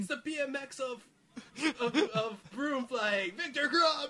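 high, loud laughing cry; laughing excitedly. Real, not acted. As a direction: shout the name through laughter. Echoing the player's entrance.

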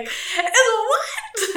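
high, loud imitation through laughter; older smoker impression. Older coworker reacting to the answer, mimicked high and loud. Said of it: "That's what she sounded like".

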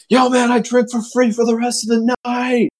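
very high, elated mock holler; high sustained party yell, acted out; drunken bragging. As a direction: imitate a drunk buddy crowing in triumph. Drunk buddy boasts of free drinks.

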